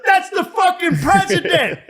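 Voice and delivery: very high, loud yell; hammed-up holler of disbelief; mock astonished bystander. Bystander pointing out the president to others, mock astonished yell.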